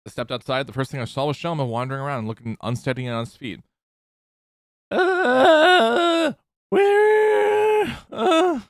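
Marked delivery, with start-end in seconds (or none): 4.9-8.6 panicked shout. Acted. Voicing a dazed character.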